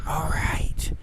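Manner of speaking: hushed in-character whisper. Acted. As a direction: breathe it under your breath. In-character plan, sneaking past intruder.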